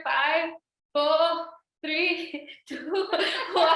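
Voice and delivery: high, loud countdown that eases off. Real, not acted. Instructor counting down the last seconds, loud and high. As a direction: count them down energetically.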